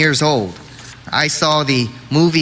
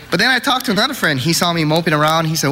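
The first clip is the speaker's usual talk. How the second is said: ordinary talk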